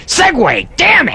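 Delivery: high, clipped-off imitation of a frustrated host; a hammed-up cranky version of John Monez; mock John Monez. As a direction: play his annoyed inner voice. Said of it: "John Monez was thinking".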